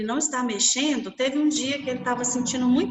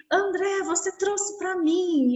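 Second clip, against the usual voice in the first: much higher, drawn-out and slow with joy; slow it down, marvel at the gift; overjoyed boy; 8 semitones higher, slower than usual. Retold or imitated. Boy tells his brother he brought healing, slow and high.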